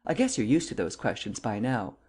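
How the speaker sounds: ordinary delivery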